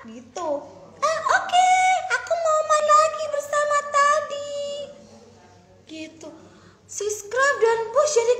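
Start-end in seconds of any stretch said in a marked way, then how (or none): 1.0-4.6 very high, loud eager voice; storybook girl, delighted; eager princess. Acted. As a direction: bounce with excitement to play. Princess wants to play.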